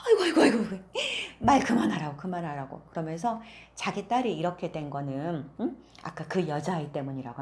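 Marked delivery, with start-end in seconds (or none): 0.1-2.8 loud, high anguished wail; mother cries out in dismay; horrified wailing. Acted. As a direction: wail in horror at the snakes.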